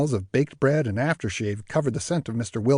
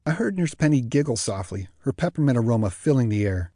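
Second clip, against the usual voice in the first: ordinary delivery, nothing marked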